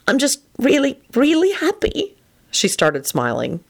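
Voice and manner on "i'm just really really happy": high, slowing voice of happiness; overjoyed. A narrator's reading. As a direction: let the joy come out haltingly. Tearful reply to love confession.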